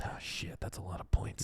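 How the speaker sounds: hushed awed whisper. Real, not acted. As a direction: breathe it out in a whisper. Reacting to all-in wager.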